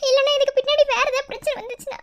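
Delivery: high, sobbing worry; crying. Acted. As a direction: plead through steady tears. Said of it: "நீ எதுக்கு சம்பந்தமே இல்லாம அழுதுட்டு இருக்க". (அழுதபடியே கவலையோடு)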